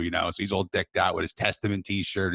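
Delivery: normal talking voice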